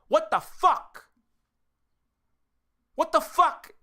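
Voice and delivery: loud, high exasperated yell. Real, not acted. Streamer yelling at chat in disbelief. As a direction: blast it out in disbelief. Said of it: "trying to say it as loud as possible".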